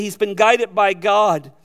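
usual speaking voice

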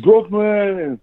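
high, drawn-out catchphrase; Miles. Retold or imitated. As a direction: imitate a kid copying his movie hero. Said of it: "my son do that every day".

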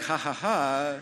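spelled-out imitation of a laugh; mock laugh. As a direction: act out the rabbi's expected chuckle in separate ha's. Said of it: "waiting for him to be like".

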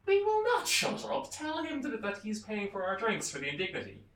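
high, loud acted defiance, slowing on the refusal; indignant retort. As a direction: shout back in mock indignation. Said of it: "And they go back".

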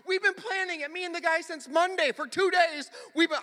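high, insistent imitation of an upset son; flustered kid. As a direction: protest like a worked-up fifth grader. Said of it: "he's all flustered, and he's all upset".

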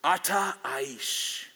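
“Ata Aish” said slowly as Hebrew ah-tah eesh; Hebrew phrase. Shown as a demonstration. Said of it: "Hebrew for".

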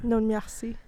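a light put-on French accent on Non merci; playful mock French accent. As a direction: say it like a Parisian, lightly. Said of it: "The worst French accent I ever heard".

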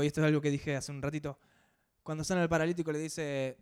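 ordinary talk

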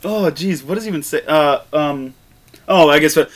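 ordinary delivery

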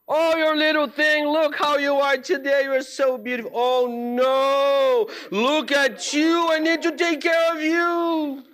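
high, loud cooing to her plants like a mother to babies; mother fussing lovingly over her little ones; doting mother. Retold or imitated. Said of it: "talking like this to her kids".

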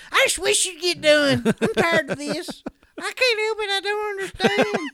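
a mother worn out by homework; exasperated mom. Retold or imitated. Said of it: "my mom".

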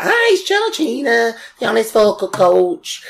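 put-on high, bright northern English accent; Georgina northern accent. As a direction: greet like a cheery northern English vocal coach. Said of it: "she's got her northern accent".

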